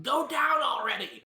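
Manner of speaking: loud, high, drawn-out battle yell; exasperated shout. Acted. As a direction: shout it slowly at a foe who won't fall. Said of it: "Scrap's gonna shout".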